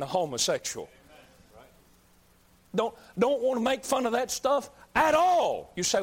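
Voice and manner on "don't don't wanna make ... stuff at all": ordinary talk, nothing marked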